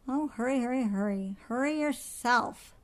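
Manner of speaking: put-on crabby child talking back; mildly petulant girl's mimicry; sulky retort. A narrator's reading. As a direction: throw it back crossly. Girl grumbling back at mother.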